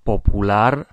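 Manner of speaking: "Popular" said as Spanish, po-poo-LAR; Spanish pronunciation. Shown as a demonstration. Teacher models words for learners to notice, Spanish pronunciation. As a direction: stress the last syllable of "Popular", the Spanish way. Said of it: "pay attention to the pronunciation".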